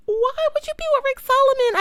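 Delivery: high disbelieving question; incredulous. Real, not acted. Asking Pam about her new man, incredulous and high. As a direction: ask it in sheer disbelief, high.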